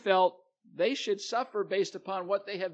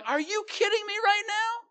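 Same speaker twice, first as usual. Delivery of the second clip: much higher, loud, put-upon disbelief; outraged sibling; 9 semitones higher, 5 dB louder than usual. Retold or imitated. Sibling protests to parents over a curfew pass, high and outraged. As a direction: squeal it like the kid who played by the rules. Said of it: "you're sitting there going".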